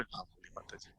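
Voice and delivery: soft, gentle turn to the mother, no anger; calm polite reply. Shown as a demonstration. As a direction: switch at once to calm courtesy. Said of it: "तो मेरा control है". (नरमी से, शांत होकर)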